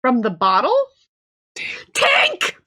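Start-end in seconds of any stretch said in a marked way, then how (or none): 1.9-2.6 high-pitched mock-horrified cry; anguished cry. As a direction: cry out for a lost companion. Crying out for lost Tank.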